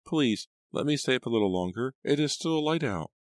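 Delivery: put-on high little-girl voice, wheedling; very high childish plea; pleading child. A narrator's reading. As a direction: beg Papa like a small girl. Child begging father at bedtime.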